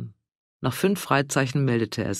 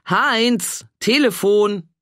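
a louder, higher second call; 7 semitones higher, 5 dB louder, much slower than usual. A narrator's reading. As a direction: yell it across to the garden. Ruft Heinz erneut, lauter. (Ehefrau ruft ihren Mann erneut, lauter rufend)

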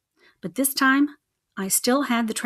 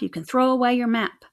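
ordinary delivery, nothing marked

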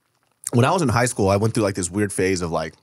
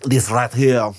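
hammy fake French accent; overdone French accent, grand and posh; exaggerated French accent. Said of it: "doing an exaggerated, uh, accent".

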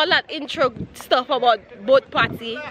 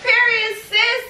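sassy higher period; 7 semitones higher, slower than usual. Pretend. Louder, higher repeat.

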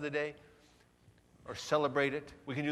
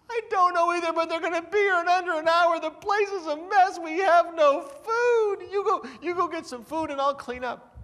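high, loud, frantic hammed-up panic; mock panic; 8 dB louder than usual. Spouse panicking about guests, high, loud, hammed-up panic. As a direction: babble in alarm about the mess.

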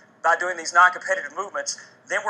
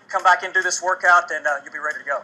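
ordinary delivery

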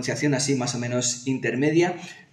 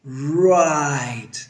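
very slow, every vowel stretched out; slow motion; much slower than usual. Shown as a demonstration. Teacher models for learners feeling like English gods, slow motion. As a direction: drag it out grandly like the god of English. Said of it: "lo hacemos en slow motion".

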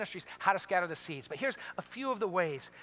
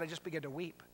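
hushed; 4 semitones lower, 10 dB quieter than usual. Retold or imitated. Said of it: "Kathy said".

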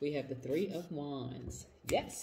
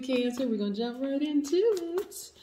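excited; 8 semitones higher, 5 dB louder than usual. Real, not acted. Hyped opening call to the sign.

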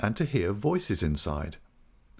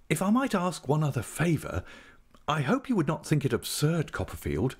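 higher-pitched, hesitant gentleman asking a favour; polite, grateful friend; 8 semitones higher than usual. Acted. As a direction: ask apologetically in a higher voice. Traddles asks a favour.

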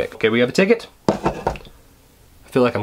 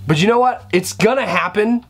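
ordinary delivery

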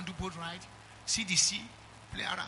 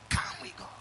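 breathy whisper of the same call. Real, not acted. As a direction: hush it like a secret. Repeated call, no voice.

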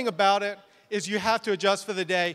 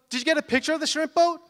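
high, quick, eager question; excited bride; 7 semitones higher, faster than usual. Retold or imitated. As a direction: press the photographer like a thrilled bride. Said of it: "so excited".

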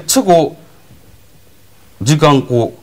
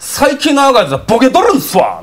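愚痴る兄貴; 8 semitones higher than usual. Retold or imitated. 兄貴の愚痴の口真似.

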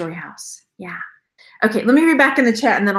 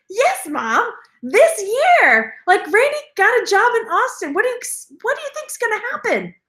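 exasperated; 11 semitones higher than usual. Retold or imitated. Exasperated reply to mom.

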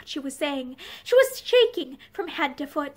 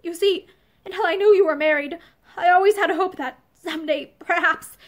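usual speaking voice

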